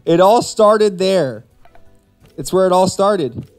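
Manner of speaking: ordinary talk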